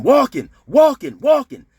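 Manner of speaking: high, loud, drawn-out call; imitated warning yell. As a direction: holler it down the tier as a lookout. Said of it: "I hear somebody yelling".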